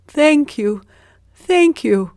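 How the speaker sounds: high, loud eager thanks; grateful beggar. A narrator's reading. Poor man thanks Zheng for the fish, high and loud. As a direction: gush your thanks. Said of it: "said the man".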